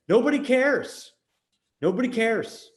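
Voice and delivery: high, loud exasperated outburst; emphatic dismissal. Acted. Coach answering worries about having an accent, emphatic dismissal.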